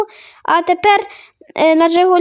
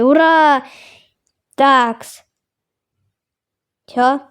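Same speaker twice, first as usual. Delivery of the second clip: usual speaking voice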